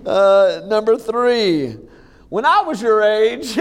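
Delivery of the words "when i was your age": mock dad lecture. Dadism quoted as dad.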